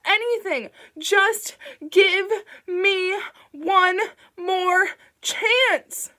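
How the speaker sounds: high, loud, slow drawn-out scream; desperate slow howl. A narrator's reading. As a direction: stretch out the screamed plea. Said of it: "Bing screamed".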